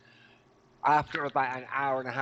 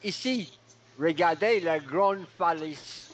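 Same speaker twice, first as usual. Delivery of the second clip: high, slow put-on French guide voice; lightly hammed French sightseeing commentary; mock French tour guide; 4 semitones higher, slower than usual. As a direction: point out the cliff like a hammy French guide. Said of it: "one way of saying this with one word".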